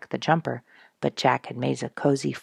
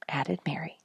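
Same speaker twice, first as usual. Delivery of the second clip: ordinary delivery, nothing marked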